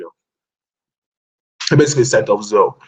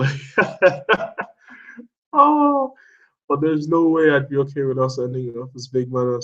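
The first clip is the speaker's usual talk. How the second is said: normal talking voice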